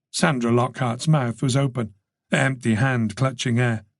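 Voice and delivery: plain reading voice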